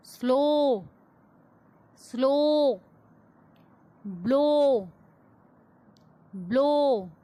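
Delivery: words like "स्लो" one by one, clusters blended; model pronunciation. Shown as a demonstration. Teacher saying repeat after me, isolated clear words like "स्लो". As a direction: say "ब्लो", "स्लीप" separately, long pauses for repetition. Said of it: "repeat after me".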